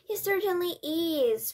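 high, loud agreement; boy's shout. A narrator's reading. Mouse boy interrupts from the handbag, high shout. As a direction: pipe up loudly in a high voice. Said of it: "Bruno shouted from inside the handbag".

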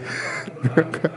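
short embarrassed laugh. Real, not acted. After reassuring "you guys are fine.

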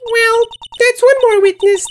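put-on high young girl voice; a girl asking what's next; Maya. Maya's voice.

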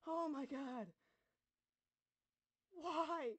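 high, loud anguished screaming; screaming while falling. Real, not acted. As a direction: scream it out in disbelief. Screaming after falling in game.

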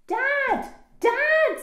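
put-on high, loud child's call for Dad. A narrator's reading. Daisy turns to Dad for help, calling out. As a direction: shout urgently to get Dad's attention.

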